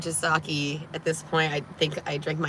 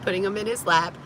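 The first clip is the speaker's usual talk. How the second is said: ordinary talk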